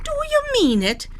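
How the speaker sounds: can't believe his luck; amazed. Acted. Leprechaun offered all the gold, amazed high question.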